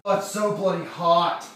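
loud exasperated moan; loud exasperated complaint. Real, not acted. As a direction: groan it out, fed up with the heat. Complaining about heat.

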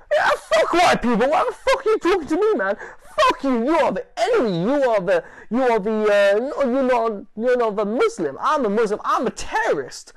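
loud, very high furious shouting; full-blast imitation of a raving man; threatening rant. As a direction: act out an unhinged, threatening tirade. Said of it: "he starts threatening".

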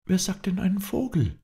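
high-pitched miffed princess; slightly annoyed. Acted. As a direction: retort with mild irritation, voice up high. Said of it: "antwortete die Prinzessin etwas ärgerlich". (etwas ärgerliche Prinzessin, hohe Stimme)

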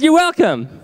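put-on high, tiny toddler voice. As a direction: imitate your eighteen-month-old daughter proudly. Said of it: "she says".

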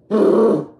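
loud, high bark of a word; loud, high, frustrated okay; sudden yell. Real, not acted. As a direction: burst out then catch yourself. Angry outburst on camera.

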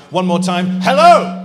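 big loud greeting that climbs on hello; rousing shout. Real, not acted. Host rallying the awards audience, rousing shout. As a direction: belt out a hello to the whole crowd.

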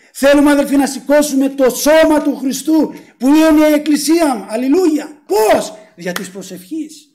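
rally the congregation with force; fervent preaching. Real, not acted. Preacher exhorting the church. (φλογερό κήρυγμα με δυνατή φωνή)

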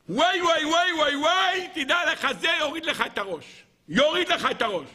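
high, wailing warning; mock alarm. Magicians warning Pharaoh about the child, alarmed wailing. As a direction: wail the warning high, hamming up the dread.

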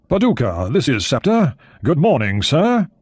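put-on clipped British naval officer on the radio, English accent. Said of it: "He was speaking in an English accent".

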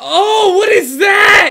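high, loud, drawn-out cry of alarm; shocked scream. Real, not acted. Gamer reacts to a screeching voice message, shocked scream. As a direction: scream it out slowly in horrified surprise.

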